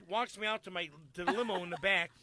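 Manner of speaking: ordinary talk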